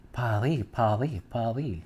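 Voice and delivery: "Paris" as pah-REE with a throaty R, no final S; soft French "Paris", R from the throat, S dropped. Shown as a demonstration. Vlogger showing viewers the French way, French pronunciation. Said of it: "that's where I learned how to pronounce Paris".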